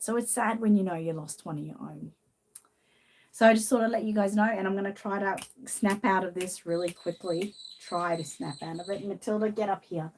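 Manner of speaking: ordinary talk, nothing marked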